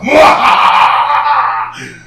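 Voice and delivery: slow loud evil cackle; sneering panto villain. Acted. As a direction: cackle like a jack-in-the-box villain. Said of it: "he pops out and goes".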